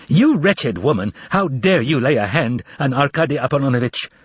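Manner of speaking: usual speaking voice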